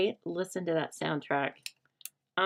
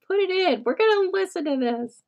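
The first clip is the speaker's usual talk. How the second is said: laughing hysterically; 12 semitones higher, 5 dB louder than usual. Retold or imitated. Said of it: "laughing hysterically and was like".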